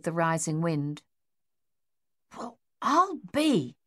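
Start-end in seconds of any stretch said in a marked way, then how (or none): 2.4-3.8 muttered. Acted. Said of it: "Captain Egan muttered".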